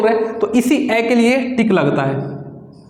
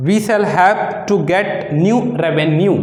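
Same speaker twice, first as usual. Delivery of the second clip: slow dictation; much slower than usual. Shown as a demonstration. Dictating shorthand exercise.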